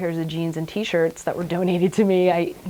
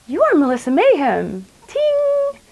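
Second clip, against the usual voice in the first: bright sing-song proclamation ending in a high, squeaky "ting"; grand mock coronation, last word squeaked way up high; whimsical fairy dubbing; 11 semitones higher, 7 dB louder than usual. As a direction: play the tripping fairy knighting someone with her wand. Acid Fairy dubbing with her wand.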